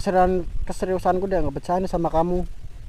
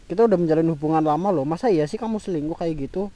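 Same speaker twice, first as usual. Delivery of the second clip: usual speaking voice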